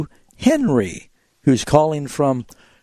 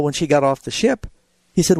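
ordinary talk, nothing marked

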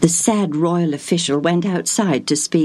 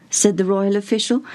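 plain reading voice

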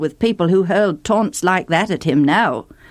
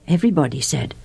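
usual speaking voice

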